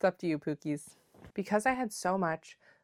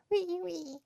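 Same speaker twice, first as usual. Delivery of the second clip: tiny cute voice; 12 semitones higher, 6 dB quieter than usual. Real, not acted. Showing tiny mittens.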